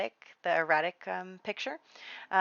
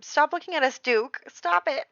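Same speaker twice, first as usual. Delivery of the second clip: high, loud, teasing shoo at the onlooker; mock-scolding the Duke, pitched up and loud; playful call-out; 9 semitones higher, 6 dB louder than usual. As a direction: laughingly tell the nosy Duke to stop staring. Calling out to the watching Duke.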